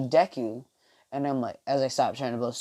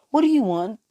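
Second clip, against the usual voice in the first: higher-pitched puzzled question; baffled and pitched up; confused; 9 semitones higher, 4 dB louder than usual. Acted. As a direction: ask it high, thrown off. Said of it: "He's, like, confused".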